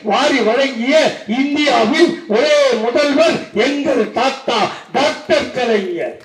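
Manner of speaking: high-pitched rally speech building to full-voiced shouting; impassioned political oration. Real, not acted. Orator reels off grandpa's free schemes to the crowd, impassioned and building. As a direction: drive the list relentlessly up to a loud, high climax.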